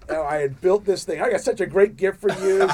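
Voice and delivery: loud, bragging gift-giver; puffed up with pride; proud and excited. Retold or imitated. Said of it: "it was like".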